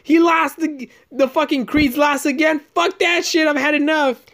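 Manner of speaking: mock shriek, pitched high throughout; high screech. Imitating the angry wife in the theater, high mock shriek. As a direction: screech like an outraged fan. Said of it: "She's like".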